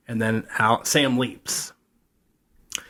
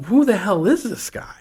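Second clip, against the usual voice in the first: incredulous; 9 semitones higher than usual. Real, not acted. Rhetorical puzzled question.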